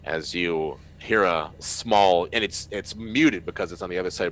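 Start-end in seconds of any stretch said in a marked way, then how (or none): none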